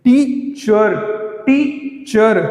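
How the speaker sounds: Teacher said twice, first syllable stressed, falling after; stress on टीच. Shown as a demonstration. Teacher demonstrating word stress to students, teacher stressed at the front. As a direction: press on the टी of teacher, let चर drop. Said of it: "टीच आपका यहाँ पे stress हुआ".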